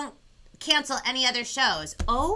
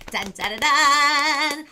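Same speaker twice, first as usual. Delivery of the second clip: drum roll; 5 semitones higher, 9 dB louder, slower than usual. Acted.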